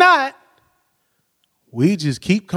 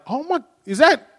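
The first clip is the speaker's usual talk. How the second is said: startled disbelief; 8 semitones higher than usual. Retold or imitated. Man finding oil under his car, acted startled disbelief. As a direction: react as if just spotting trouble. Retold discovery of oil leak.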